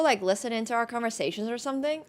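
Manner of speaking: ordinary talk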